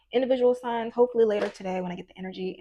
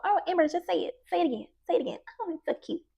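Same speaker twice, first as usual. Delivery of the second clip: put-on higher, gushing adult coaxing a child; doting church ladies; 8 semitones higher, much faster than usual. Grandmother's friends urging little Amber to recite, gushing. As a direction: coo at the little girl to perform. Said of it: "whenever her friends would come over".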